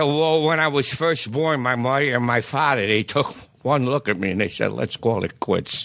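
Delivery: a hint of a punch-drunk boxer answering his straight man; punch-drunk fighter voice. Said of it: "I'm talking like this".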